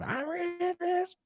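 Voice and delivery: very high, slow squeaky character voice; Ashy Larry impression. Quoting Ashy Larry's famous line, very high and slow. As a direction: stretch it out in a high cartoonish squeak. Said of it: "that's a famous character he played".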